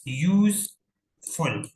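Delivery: यूजफुल very slowly, with a gap before फुल; teacher spacing out both syllables of यूजफुल; two separate syllables. Shown as a demonstration. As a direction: split यूजफुल into यूज and फुल. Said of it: "जिसमें दो syllable हो".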